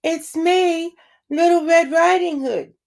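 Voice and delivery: put-on high, slow sugary girl voice from a wolf; wolf pretending to be a sweet child; wolf faking a little girl's call; fake little girl voice. A narrator's reading. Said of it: "in his best little girl voice".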